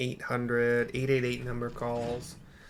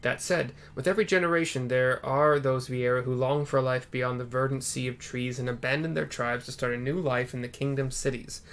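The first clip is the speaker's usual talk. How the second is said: ordinary talk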